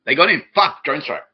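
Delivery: hammed-up alarm; mock panic. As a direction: fake panic. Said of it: "And it's like".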